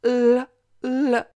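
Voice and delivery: "L" as a held "lll", twice; isolated L sound. Shown as a demonstration. Teacher showing the tongue like a slide, L held long. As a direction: sound out a long L, pause, repeat it. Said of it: "tu dois prononcer le son L".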